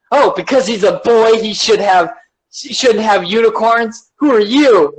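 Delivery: high, indignant challenge; mock offended scold. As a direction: play an outraged busybody demanding answers. Mocking the oversensitive accusers.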